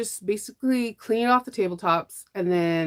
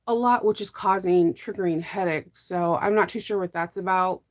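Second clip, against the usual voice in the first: usual speaking voice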